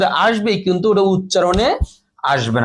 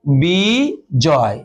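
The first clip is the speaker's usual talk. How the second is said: Digvijay drawn out very slowly, ব clearly sounded, dig-bi-joy; slow demonstration; 3 semitones lower, much slower than usual. Shown as a demonstration. Teacher slowly modelling Digvijay for students. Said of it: "বর উচ্চারণটা স্পষ্ট এখানে হলো".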